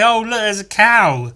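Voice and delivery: sarcastic fake panic; mock surprise at the cow; mock alarm. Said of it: "I'd say".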